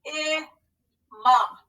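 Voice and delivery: slow, syllable by syllable; scooping the word in parts; scooped syllables. Shown as a demonstration. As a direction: sound out each syllable slowly. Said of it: "So let's scoop and read".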